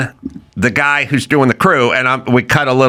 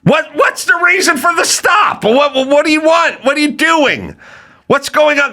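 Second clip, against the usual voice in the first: much higher, near-shouted questions; exasperated outburst; 13 semitones higher than usual. Real, not acted. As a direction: fire off the questions in real disbelief. Exasperated rant at nosy people.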